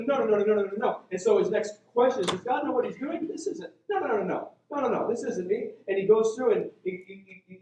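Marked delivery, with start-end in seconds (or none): 0.0-1.0 higher, louder burst of panicked objection; imitated frantic protest; protesting refusal. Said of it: "Like, he just starts". 2.3-5.7 higher-pitched imitated protest; acted-out panicky backpedaling; flustered objection. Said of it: "Gideon just word vomits".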